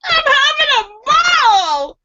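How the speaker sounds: high, slow shouted glee; gleeful shout. Acted. Cannibal gleefully shouting to his partner, high and loud. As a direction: belt it out with glee.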